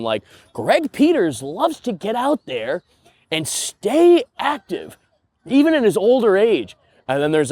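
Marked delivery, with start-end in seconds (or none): 0.5-6.8 high, slow mock news-feature voice; TV segment narrator. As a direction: read it like a cheesy Sunday morning TV piece. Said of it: "a C B S Sunday morning segment".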